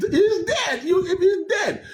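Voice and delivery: high laughing mockery. Real, not acted. Mocking a rival's claim on air, laughing high. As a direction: laugh the words out in disbelief.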